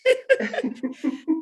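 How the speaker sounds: laugh. Real, not acted. Joking about her waistline.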